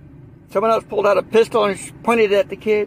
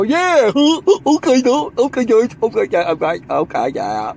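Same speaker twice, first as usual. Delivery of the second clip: mock gullible listener; 2 semitones higher than usual. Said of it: "They're just gonna listen to us and go".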